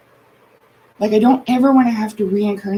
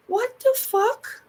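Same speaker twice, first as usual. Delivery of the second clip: higher and slow, stunned; incredulous disbelief; 11 semitones higher, slower than usual. Real, not acted. Hearing grandma's spell-work list, slow stunned disbelief. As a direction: let it rise in stunned disbelief.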